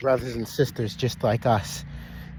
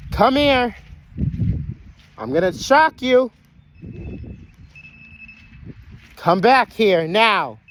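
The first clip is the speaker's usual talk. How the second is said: very high, loud holler; real exasperated shouting; shouted command; 11 semitones higher, 6 dB louder than usual. Real, not acted. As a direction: yell a threat to bring him back. Threatening runaway Jake to return.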